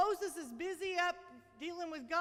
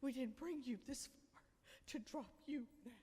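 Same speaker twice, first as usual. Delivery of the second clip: softer, nearly hushed; hushed reassurance; 3 semitones lower, 10 dB quieter than usual. Acted. Parent soothing an afraid child, hushed reassurance. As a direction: say it again, closer and gentler. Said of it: "to say".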